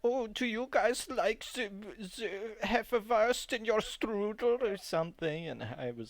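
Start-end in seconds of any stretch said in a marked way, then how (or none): 0.0-4.6 higher-pitched, trollish fake German accent; mock German accent. Coworker imitating the fake German new hire, mock accent. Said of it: "doing accents to each other".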